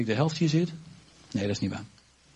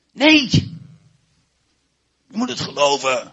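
emphatic no; 3 semitones lower, 9 dB louder than usual. Real, not acted. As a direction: shout it down. Preacher rejects seeing first.

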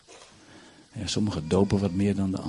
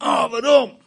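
high, loud acted lament; anguished outcry; 13 semitones higher, 10 dB louder, slower than usual. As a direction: cry out the question to heaven. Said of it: "dan denk ik". (luide, wanhopige uitroep)

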